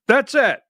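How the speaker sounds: high, falling "That's it." with weight on "it"; emphatic that's it. Real, not acted. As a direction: land hard on "it", final. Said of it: "When I say that's it".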